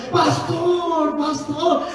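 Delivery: high, drawn-out imitated greeting; gushing church member. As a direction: call out like a brother welcoming his pastor. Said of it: "hay hermanos que nos reciben". (hermano saludando efusivo, agudo y alargado)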